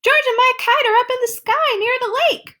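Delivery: high, loud, fast shout; Bill shouting the news in a panic. Acted. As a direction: blurt it out in panic. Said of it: "Bill shouted".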